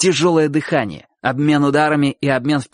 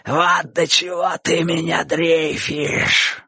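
higher, slow gloating crow; gloating delight; 5 semitones higher, much slower than usual. A narrator's reading. Bocho crowing over frightened Chik, joyful gloating. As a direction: draw it out with glee. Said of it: "закричал Боча радостно".

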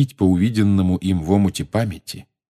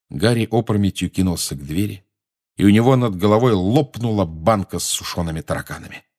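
ordinary delivery

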